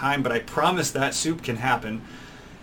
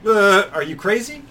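incredulous; 7 semitones higher, 6 dB louder than usual. Retold or imitated. Imagined reaction to parkour.

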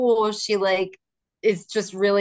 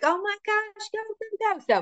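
excited cheering. Retold or imitated. Teacher cheering on the child.

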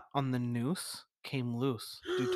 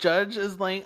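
ordinary talk, nothing marked

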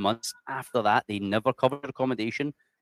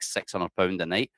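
normal talking voice

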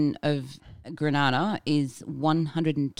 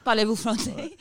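mock Australian-accented French; 5 semitones higher than usual. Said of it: "with a massive Australian accent".

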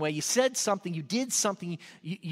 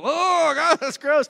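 high, loud groan of disgust; disgusted outcry; 7 semitones higher, 8 dB louder, slower than usual. Retold or imitated. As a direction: act out drivers recoiling from a stench. Passers-by recoiling at the stink.

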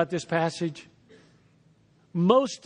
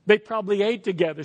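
ordinary talk, nothing marked

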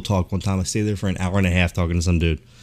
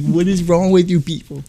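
high put-on outrage at the staff; mock yelling; 9 semitones higher than usual. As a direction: imitate an exasperated customer, pitched high. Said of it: "He's yelling at the guy".

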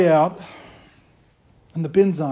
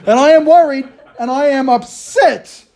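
much higher and louder, dragged slowly; mock exasperation; 7 semitones higher, 6 dB louder, slower than usual. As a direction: wail it like a harried dad. Repeated exasperated punchline.

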